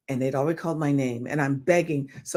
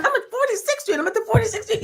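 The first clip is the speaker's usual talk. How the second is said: much higher, indignant insistence; insisting; faster than usual. Retold or imitated. Telling agent where she is, much higher indignant insistence. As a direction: squeak out the address.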